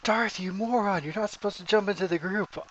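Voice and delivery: high, loud scolding yell in imitation; mock yelling. Imagined teammate scolding a player for jumping in, mock yelling. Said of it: "sits there and yells at me".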